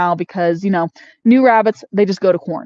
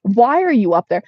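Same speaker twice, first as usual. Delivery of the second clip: ordinary talk, nothing marked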